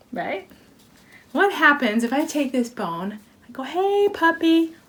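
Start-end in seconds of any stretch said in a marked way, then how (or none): 3.6-4.8 high, drawn-out puppy call, slow. Pretend. Teasing dog before throwing bone.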